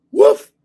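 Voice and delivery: a high, loud single woof like the dog; dog bark. Retold or imitated. Imitating his dog answering at the garage door, high loud bark. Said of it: "And he'd go".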